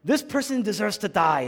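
emphatic courtroom accusation; accusing. Retold or imitated. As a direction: make the case against them forcefully. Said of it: "The accuser says".

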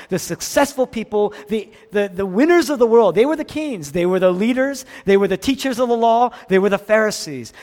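loud, high, driving list; forceful, escalating sermon rhetoric; impassioned preaching. Real, not acted. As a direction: hammer each phrase in a mounting volley.

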